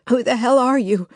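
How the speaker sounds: breathless, panting demand. Acted. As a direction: gasp it out, out of breath. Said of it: "Lily panted".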